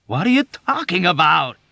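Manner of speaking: high loud fearful shout; dread shout. A narrator's reading. Yossarian shouting at Dunbar in dread. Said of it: "he shouted with dread".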